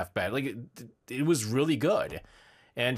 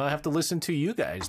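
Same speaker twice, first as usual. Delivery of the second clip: ordinary delivery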